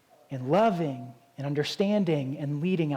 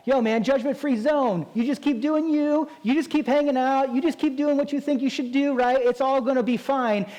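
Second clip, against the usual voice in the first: high, breezy gym-bro voice, way up; careless gym bro; 7 semitones higher than usual. Retold or imitated. Bystander at the gym waving off a bad lift, breezy bro voice. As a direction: brush it off like a lazy bro. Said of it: "someone walked by and be like".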